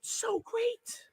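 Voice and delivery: So great squealed very high and soft; So great in a breathy high squeak; excited squeal. Real, not acted. Gushing about wrestling.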